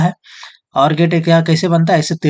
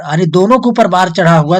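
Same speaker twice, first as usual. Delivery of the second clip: ordinary talk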